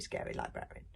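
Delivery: usual speaking voice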